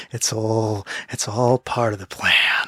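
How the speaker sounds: Joker. Acted. Joking about a league conspiracy, sinister villain impression. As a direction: hush it like a scheming mastermind. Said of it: "that was a pretty good Joker impression".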